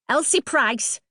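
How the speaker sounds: shrill angry neighbour shouting; high, hard call across the yard; shouted. A narrator's reading. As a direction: yell it out like an irate mother. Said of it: "she shouted".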